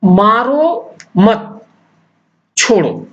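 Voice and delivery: मारो मत said very slowly, falling on मारो; slow with pause. Shown as a demonstration. Teacher to class, slow demo of where the pause falls. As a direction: drag it out, a pause after मारो. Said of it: "जब विराम चिन्ह होता है".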